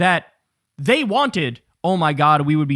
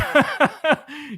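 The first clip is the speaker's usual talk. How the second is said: a high, real laugh; high cackle; 9 semitones higher than usual. Real, not acted. Mocking a commentator's chin, a high laugh. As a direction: burst out laughing mid-sentence.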